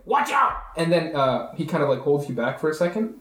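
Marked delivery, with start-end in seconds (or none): 0.1-0.5 high-pitched urgent warning cry; sudden warning. Acted. Trevor jumping in front of the party, sudden warning. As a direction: call out a sudden alarm, pitched way up. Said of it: "jumps in front of you and he's like".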